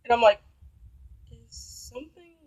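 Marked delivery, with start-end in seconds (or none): 1.3-2.3 slow, very soft asking, a little higher; hushed cautious question. Retold or imitated. Woman checking on sulking date, hushed and cautious. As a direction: tread carefully, almost whispering.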